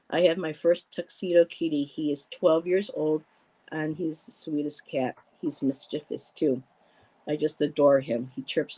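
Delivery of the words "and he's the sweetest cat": usual speaking voice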